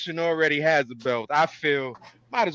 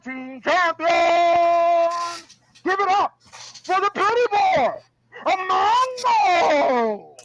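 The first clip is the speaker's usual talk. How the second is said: shouted wrestling intro, stretched out; ring announcer; 10 semitones higher, slower than usual. Acted. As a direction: bellow each title to the arena. Announcing the producer.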